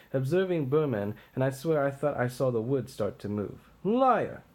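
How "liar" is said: sharp, higher outburst; king snapping at a messenger; angry accusation. Acted. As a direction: spit the word at him.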